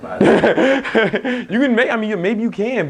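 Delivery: laughing, high and very slow. Real, not acted. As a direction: laugh it out at the silly idea. Laughing at his car question.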